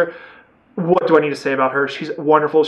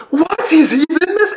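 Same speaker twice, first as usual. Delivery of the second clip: much higher, stunned "what, he's in this"; shocked disbelief; 12 semitones higher, 3 dB louder than usual. Retold or imitated. Reviewer recalling seeing an actor he likes, high stunned disbelief. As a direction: gasp the words out in delighted disbelief.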